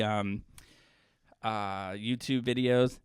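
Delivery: usual speaking voice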